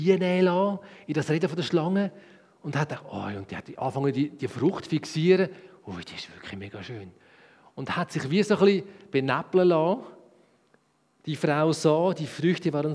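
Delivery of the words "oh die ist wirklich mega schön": Eva admiring. Acted.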